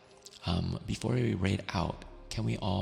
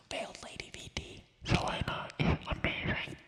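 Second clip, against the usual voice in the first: ASMR whisper; 3 dB quieter than usual. Real, not acted. Streamer urging viewers to check out a friend, whispered. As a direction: whisper the praise deliberately.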